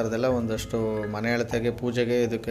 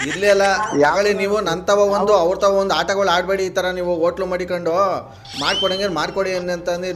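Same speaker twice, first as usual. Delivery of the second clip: louder, higher irritated complaint; annoyed; 5 semitones higher, 5 dB louder than usual. Acted. Prank caller scolding the hotel owner, louder annoyed voice. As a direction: scold them for playing games.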